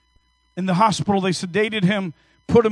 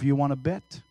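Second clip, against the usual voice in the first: quiet aside; 6 semitones lower, 6 dB quieter than usual. Retold or imitated. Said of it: "And I said to myself".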